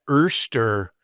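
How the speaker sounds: OYSTER said slowly as ERSTER. Shown as a demonstration. Co-host repeating what certain people say, oyster as erster. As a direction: draw out ERSTER with an er vowel. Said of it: "something that certain people say".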